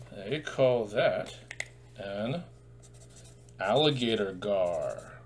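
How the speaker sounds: drawn-out, deliberate teacher's reveal; slow careful naming. Shown as a demonstration. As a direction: spell the name out slowly. Teacher names the fish.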